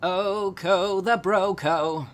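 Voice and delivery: high, loud, drawn-out [name] impression; [name] accent. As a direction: draw it out slowly like a put-on [name]. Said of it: "I went with a [name] accent there".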